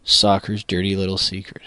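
quiet, distant take off the mic; softly, as if leaning away from the mic; too far from the mic. Shown as a demonstration. Opening the segment with a hushed read set too far off. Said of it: "Is the mic not close enough".

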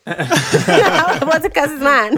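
high, laughing repeat. Real, not acted. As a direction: laugh it out in disbelief.

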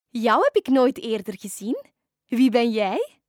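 loud, puzzled questions with rising ends; surprised witch. Acted. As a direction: question a stranger in astonishment. Witch surprised by unknown dolphin. (verbaasde heks)

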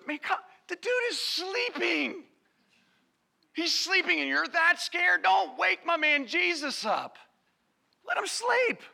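high-pitched protesting disciple; high, put-on scolding of a worried friend; mock indignant objection. Another disciple objects to waking Jesus.

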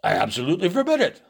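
thundering. A narrator's reading. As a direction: forbid it outright in indignation. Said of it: "thundered the colonel".

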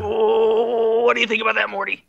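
high, loud drunk cartoon scientist; Rick voice.

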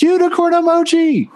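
high, squealing delight; giddy squeal. Retold or imitated. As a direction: squeal it like a thrilled kid. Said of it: "Ryan looks at the changelog and goes".

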